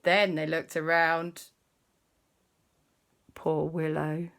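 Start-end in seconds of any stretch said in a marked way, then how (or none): none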